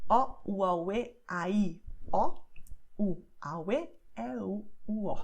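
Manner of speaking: O, u, a, u, e, a, i, o with the o's turned to u; final o as u. Shown as a demonstration. Teacher showing that final o becomes u. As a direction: sound out the vowels, swapping o for u. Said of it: "be pronounced as U".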